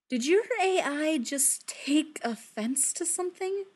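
slow and puzzled; slowly. Acted. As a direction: ask it slowly, baffled. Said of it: "Natasha says slowly".

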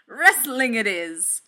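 slow, loud, drawn-out proclamation; roaring. A narrator's reading. Thor announcing a wrestling match, roaring. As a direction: bellow it, stretching the words. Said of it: "he roared".

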